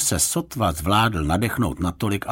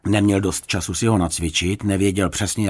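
plain reading voice

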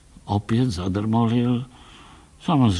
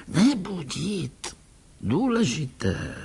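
put-on a soft, slow old man's voice, higher; hushed old doctor; 7 semitones higher, 4 dB quieter, slower than usual. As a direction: keep it hushed so the sleeper stays asleep. Old doctor whispering not to wake.

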